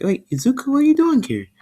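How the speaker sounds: put-on startled girl, voice climbing very high; high surprised. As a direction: pitch soaring up in disbelief. Girl surprised to see classmate.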